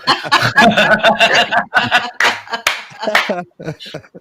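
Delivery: long, high, loud burst of laughter; real uncontrolled belly laugh; big laugh. Real, not acted. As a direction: crack up completely. Amused at played clip.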